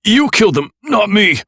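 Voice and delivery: high loud yell; yelled accusation. A narrator's reading. Nick blaming the killer, yelling. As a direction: throw the blame back hard.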